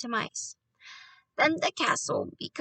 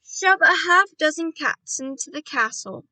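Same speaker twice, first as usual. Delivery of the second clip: loud, higher, eager instruction; crying out; 5 semitones higher, 8 dB louder than usual. A narrator's reading. Boy urging his plan on grandmother, crying out. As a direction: call it out excitedly. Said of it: "I cried".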